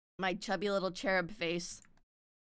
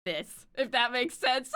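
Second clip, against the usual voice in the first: higher, breaking into laughter; laughing; 9 semitones higher, 4 dB louder than usual. Real, not acted. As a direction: let the laugh take over the words. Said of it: "My energy is more like".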